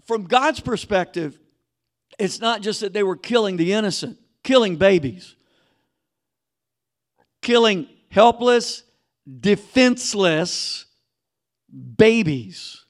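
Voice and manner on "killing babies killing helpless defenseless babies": building emphasis. Real, not acted.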